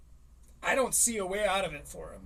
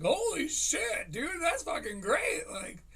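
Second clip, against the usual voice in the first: put-on higher, excited gushing at the kid; mock hyped praise; 5 semitones higher than usual. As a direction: hype the kid up enthusiastically. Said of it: "we're like".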